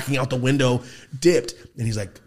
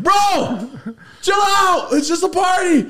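shouting to calm him; 6 dB louder, slower than usual. Retold or imitated. Calling out to his friend at a party.

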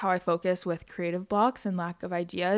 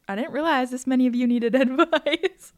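giggling through the words, louder; amused disbelief, laughing it out; 6 semitones higher, 5 dB louder than usual. Real, not acted. Laughs at number of entries.

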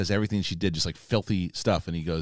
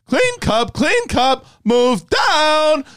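high, loud cartoon madman; Mad Hatter; 6 dB louder, much slower than usual. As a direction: call out the seat change in a high, crazed voice. Quoting Mad Hatter at the tea party.

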